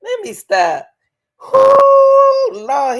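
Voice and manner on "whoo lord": high, loud whoop, then slow drawn-out words. Real, not acted. Reacting to the witness's answer, a loud disbelieving whoop.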